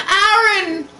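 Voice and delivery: high, loud mock call of the name; hammed-up cry. A narrator's reading. Reading fanfic climax to friends, hammed-up cry. Character climax line read aloud.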